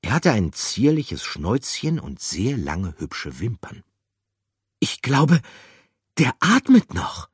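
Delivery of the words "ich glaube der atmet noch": high, loud relieved exclamation; Gruyère calls out, relieved. Acted. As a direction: call out with relief. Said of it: "rief sie erleichtert".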